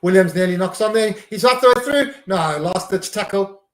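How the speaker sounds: excited commentary. Real, not acted. Fan commentating his team's rugby match live, excited. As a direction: call the near-knock-on with climbing thrill.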